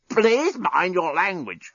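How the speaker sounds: slow, strained croak from a throttled robot. Acted. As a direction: drag it out, throat squeezed. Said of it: "croaked the despot".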